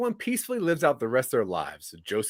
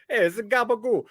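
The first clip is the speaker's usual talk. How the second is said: high-pitched mock New York voice; New York accent; 9 semitones higher than usual. Host joins the co-host's riff, silly New York accent. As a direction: ham up a New Yorker for a laugh. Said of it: "New York accent".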